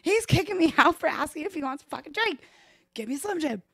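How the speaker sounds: high, loud outraged complaint; indignant shout. Retold or imitated. To Joey, a high, loud indignant complaint. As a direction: yell it at your friend, fuming. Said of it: "I'm like".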